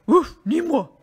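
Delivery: loud woof, then short refusal; dog voice. A narrator's reading. Dog refusing to help the hen, loud barking voice. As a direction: bark it out like a dog. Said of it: "dit le chien".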